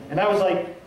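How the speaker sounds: normal talking voice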